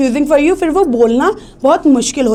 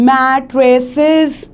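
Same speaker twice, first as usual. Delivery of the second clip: Mattresses with a long, held first syllable; drawn-out stress. Shown as a demonstration. Teacher showing that stressed syllables last longer, drawn-out Mattresses. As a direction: stretch the opening of Mattresses, syllable by syllable. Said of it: "आप उसको देर तक बोल रहे हो".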